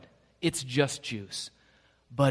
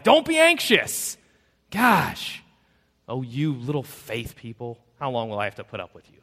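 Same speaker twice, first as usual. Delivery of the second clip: impatient scolding God; 5 dB louder than usual. Retold or imitated. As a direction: snap at them like an impatient, fed-up master. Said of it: "which is sort of like".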